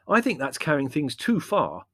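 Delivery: high, loud indignation; indignant objection; exclaiming. A narrator's reading. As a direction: protest with exasperation. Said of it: "exclaimed Larry".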